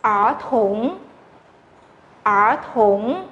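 ở thủng said with a curled tongue; retroflex demo. Shown as a demonstration. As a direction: say ở thủng with the tongue rolled back. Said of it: "Cái này sẽ đọc là". (ở thủng đọc uốn lưỡi)

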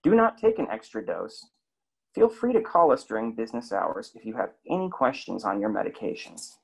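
ordinary talk

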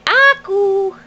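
put-on high sing-song word for baby; cooing first-word prompt; high coaxing prompt. Shown as a demonstration.

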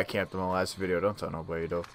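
a low, soft aside to the viewers; hushed aside. Real, not acted.

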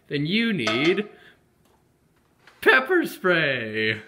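slow, loud dramatic reveal; dramatic announcer. Acted. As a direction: boom it out like a trailer.

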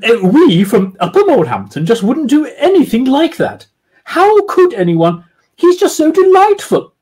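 high, loud posh lady lecturing; haughty falsetto lady; aloof schoolmistress. Acted. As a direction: speak with a schoolmistress's aloof authority. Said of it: "with the aloof authority of a schoolmistress".

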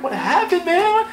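playful high-pitched question; kinda joking. Retold or imitated. Narrator teasing Dave in the lobby, joking high voice. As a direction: pitch soaring up in mock concern. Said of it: "I'm kinda joking".

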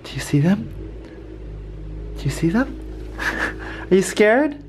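high, hushed questions to a dog; mock-alarmed squeaky whisper; playful pet talk. Owner asks the dog who is there, playfully alarmed. Teasing dog about window washers.

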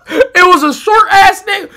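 high, loud words through laughter; laughing. Real, not acted. Storyteller describing short guy built like Kevin Hart, laughing. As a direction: keep talking while cracking up.

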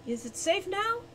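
small, high-pitched questioning voice; excited. A narrator's reading. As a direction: call down excitedly without shouting. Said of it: "An excited voice came from high above".

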